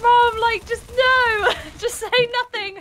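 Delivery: high pleading "Mum, just no"; high embarrassed plea; mock pleading. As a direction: beg her to stop, high and loud. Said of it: "I was just like".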